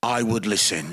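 very low, calm deadpan advice; God's voice. Acted. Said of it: "and God was like".

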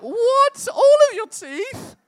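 put-on very high, loud childish disbelief; shocked little girl. Daughter hearing all teeth fall out, squealing high disbelief. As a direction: squeal it like a stunned five-year-old.

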